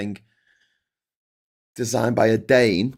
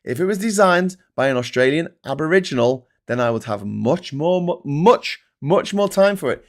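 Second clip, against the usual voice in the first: ranting reply; 7 semitones higher, 7 dB louder than usual. Retold or imitated. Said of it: "I'm like".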